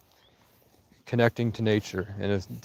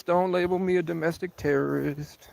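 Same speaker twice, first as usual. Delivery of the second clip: normal talking voice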